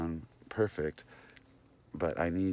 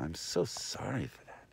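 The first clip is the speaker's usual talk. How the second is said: normal talking voice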